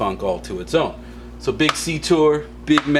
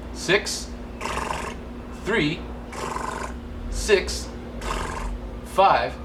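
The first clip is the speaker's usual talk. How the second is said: very slow, each digit alone with long pauses; spaced-out digits; one digit at a time; 5 semitones higher, 4 dB quieter, much slower than usual. Shown as a demonstration. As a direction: read out every digit separately, pausing between. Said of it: "Like this".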